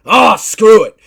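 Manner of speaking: loud, exasperated shout; frustrated yell. Acted. Drova giving up on talk before attacking Elliot, loud frustrated shout. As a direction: blurt it out and charge in. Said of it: "Drova's like".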